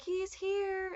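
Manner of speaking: high, happy call; excited announcement. Retold or imitated. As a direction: announce the relief. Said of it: "And my mom's like".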